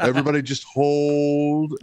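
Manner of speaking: slow, high, drawn-out command. Retold or imitated. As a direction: halt everybody with calm authority. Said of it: "in J W's voice".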